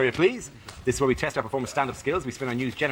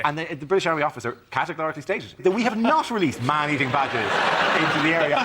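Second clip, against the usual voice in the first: usual speaking voice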